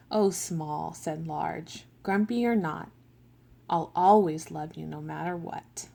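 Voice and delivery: slow, gentle storybook read; picture-book reading with voiced characters; reading to children. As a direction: read it like a bedtime book for little kids.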